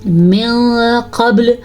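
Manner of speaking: من قبل with hidden nasal nun, slow; ikhfa. Shown as a demonstration. Teacher demonstrating ikhfa to students, slow nasal nun. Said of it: "یہاں پہ بھی اخفا کا قاعدہ".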